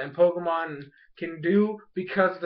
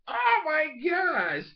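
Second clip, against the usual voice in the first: high, slow mock gasp of awe; overwhelmed reaction; 9 semitones higher, much slower than usual. Fletchling offered a battle, drawn-out high amazement.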